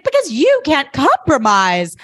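drawing it out, high and cutting; pointed retort. Retold or imitated. Lily snapping back at Rufus, slow and high.

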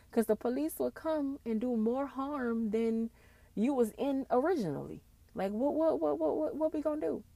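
ordinary delivery

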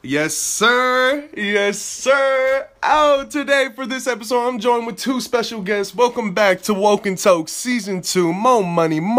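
high, loud show host intro; hype intro. Acted. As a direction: pump up the show opening.

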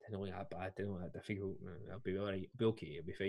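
hushed, half-whispered pep talk to himself; talking himself into it under his breath; quiet self-reassurance. Retold or imitated. Drunk guy muttering to himself before walking out on court.

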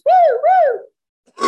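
high, loud whooping cheer. Retold or imitated. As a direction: whoop like the crowd's going wild. Cheering herself on court.

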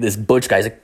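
normal talking voice

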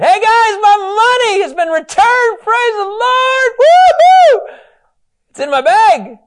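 high, loud, crying-out joy; dramatic excited cry. Shown as a demonstration. Preacher acting a brother thrilled by his money. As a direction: shout it like wonderful news. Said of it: "I'm gonna go dramatic [name] for a second".

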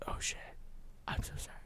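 very soft, hushed mock apology; retold shocked apology. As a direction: drop to a near whisper, imitating a sheepish friend. Friend realises he kicked wrong person.

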